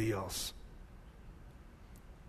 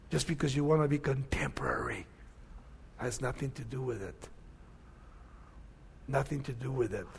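softer, whispered warning; hushed whisper; 6 semitones lower, 12 dB quieter than usual. Retold or imitated. Pastor quoting his mentor, in a hushed whisper. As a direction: drop to a whisper for the point.

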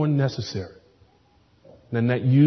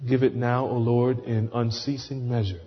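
closing prayer; faster than usual. Acted.

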